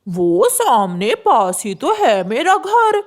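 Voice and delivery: slow, coaxing lure; sly crow. Acted. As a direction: draw him in slowly. Crow lures child.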